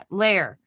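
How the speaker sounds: Lair said carefully as lay-er; practising Lair. Shown as a demonstration. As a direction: drill the word Lair, lay-er. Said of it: "fighting with that word".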